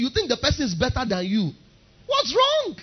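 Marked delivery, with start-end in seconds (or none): 2.1-2.8 incredulous. Acted. Incredulous rhetorical question.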